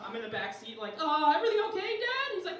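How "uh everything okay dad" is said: put-on high-pitched, anxious child voice; timid little boy. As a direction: ask dad carefully, up high. Scared child asks fuming dad.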